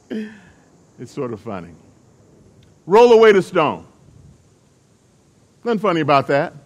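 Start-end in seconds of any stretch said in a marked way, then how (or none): 2.9-3.9 loud, firm command. Retold or imitated. Jesus ordering the tomb opened, a loud command. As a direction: order them to move it, loud.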